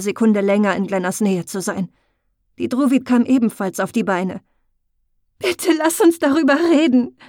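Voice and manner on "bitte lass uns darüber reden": high, louder desperate plea; pleading. A narrator's reading. Glenna pleading with Muriel not to leave, desperately. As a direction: beg her to stay. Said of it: "flehte Glenna".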